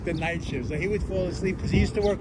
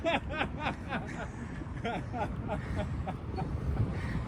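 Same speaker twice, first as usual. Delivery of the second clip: hearty laugh; 3 semitones higher, 6 dB quieter than usual. Real, not acted. Laughing at the Jethro joke.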